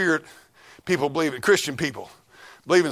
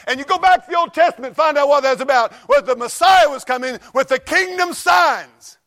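louder and higher, building to a preaching shout; rising preacher shout; 8 semitones higher, 6 dB louder than usual. Acted. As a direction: drive it home from the pulpit.

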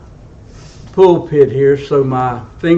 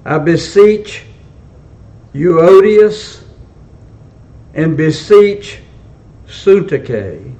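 raised, declaimed pulpit reading, pausing between phrases; emphatic scripture reading; 5 semitones higher, 5 dB louder than usual. A narrator's reading. Preacher reading Paul's exhortations to the church, emphatic scripture reading. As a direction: proclaim each phrase, then drop at the close.

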